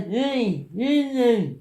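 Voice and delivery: high, slow, drawn-out heys; put-on slurry high call; drunk friend calling out. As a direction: imitate a drunk mate hollering over, stretched out.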